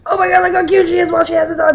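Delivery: fast, high and loud gush. Real, not acted. Owner seeing her cat in the new collar, fast excited gush. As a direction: rush out the words in delight.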